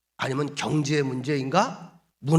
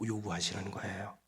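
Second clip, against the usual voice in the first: ordinary talk, nothing marked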